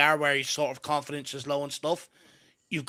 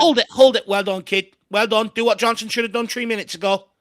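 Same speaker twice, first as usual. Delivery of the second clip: excited shouting; 7 semitones higher, 9 dB louder than usual. Real, not acted. Urging player to hold ball.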